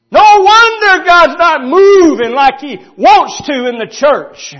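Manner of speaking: loud, high, impassioned sermon delivery; fired-up preacher hammering the point home; full-force preaching. Real, not acted.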